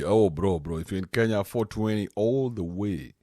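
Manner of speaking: ordinary delivery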